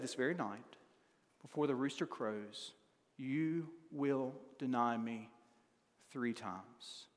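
hushed solemn foretelling; hushed warning. Acted. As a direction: drop the voice to a hush for the warning. Jesus foretells denial, hushed.

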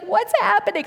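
high, alarmed little squeal; imitated panicked sister. Acting out crying, panicked sister.

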